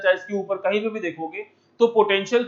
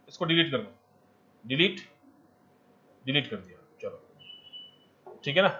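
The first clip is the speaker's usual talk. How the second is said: muttering to himself; 6 semitones lower, 9 dB quieter than usual. Real, not acted. Teacher mutters while erasing board.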